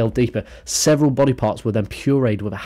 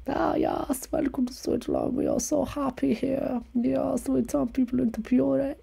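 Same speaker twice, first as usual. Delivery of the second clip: much higher, softer comic voice; mock Swiss local; 7 dB quieter than usual. Mock Swiss host greeting visitors, high soft squeaky voice. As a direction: sweetly welcome tourists in a squeaky voice.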